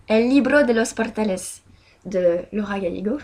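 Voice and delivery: book title said with a proud Spanish accent. Shown as a demonstration. Vlogger announcing her Spanish book title in a Spanish accent. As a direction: roll it out like a native Spanish speaker. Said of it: "mon magnifique accent espagnol".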